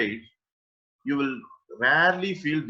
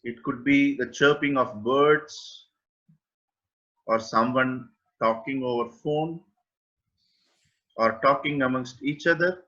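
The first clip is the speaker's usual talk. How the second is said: guided meditation. Acted. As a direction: guide listeners gently into awareness, with long pauses. (unhurried guided-meditation delivery, phrase by phrase)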